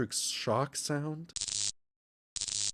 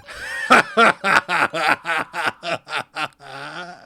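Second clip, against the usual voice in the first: long, loud staccato laugh; gloating theatrical laughter; villain cackle; 6 dB louder than usual. Acted. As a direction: cackle on and on, loudly. Villain laughs after taunt.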